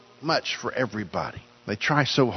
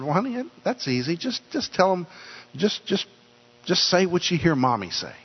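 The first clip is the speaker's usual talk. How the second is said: higher, lightly voiced patient mom; a gentle mother coaxing her little girl; sweet mother voice; 6 semitones higher than usual. Mother coaching daughter on blessing.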